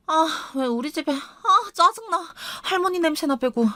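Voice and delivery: high-pitched petulant complaining; irritated, whining wife. Acted. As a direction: whine like a wife fed up with everything. Narrator voices the irritated, complaining wife.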